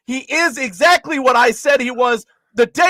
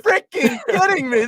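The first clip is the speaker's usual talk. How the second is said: outraged disbelief; 6 semitones higher than usual. Real, not acted. Outraged at a headline.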